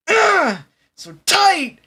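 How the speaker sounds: very high, loud squeezed wail; high strained cry. Retold or imitated. Mocking the anime hero, a high loud strained cry. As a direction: cry out like it's crushing you. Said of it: "He's going".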